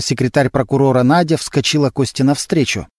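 ordinary delivery

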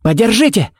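high, urgent barked command; barked order. A narrator's reading. Kevin ordering the sheriff to hold the bear, barked. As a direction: bark it out, pitched well up. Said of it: "рявкнул он".

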